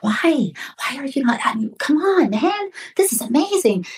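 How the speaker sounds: high-pitched, incredulous coaxing; retold pleading. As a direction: urge a reluctant friend to join in. Retells urging a reluctant friend.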